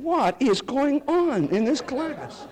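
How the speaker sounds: high, slow puzzled outcry of students; baffled students. Retold or imitated. Students baffled by the professor's tirade, puzzled outcry. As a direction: play the confused classmates, drawn out. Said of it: "the rest of the students were going".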